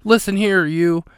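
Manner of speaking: sudden high, loud outburst; mock furious yell down the phone; retold scream. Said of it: "she screams".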